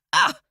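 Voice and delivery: sharp high yelp of pain; pained cry. Acted. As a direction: yelp as the arrow comes out. Arrow pulled out.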